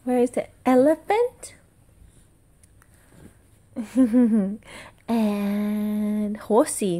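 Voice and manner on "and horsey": usual speaking voice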